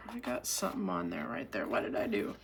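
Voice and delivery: low, half-whispered self-talk under her breath; muttered aside. Real, not acted. Crafter muttering to herself over stuck tape, hushed. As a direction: mutter to yourself over a snag.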